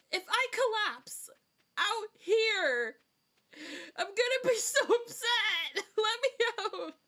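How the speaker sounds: high-pitched, loud play-panicked scream; desperate mock yell. Trapped player yelling at the game to be freed, mock-desperate yell.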